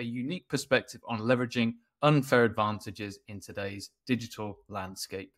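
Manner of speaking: crisp scripted host introduction, lifted at each new point; podcast intro read. Acted. Host welcomes listeners and introduces the guest, polished podcast intro read. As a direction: read out the show's intro to listeners, slowing into the sign-off.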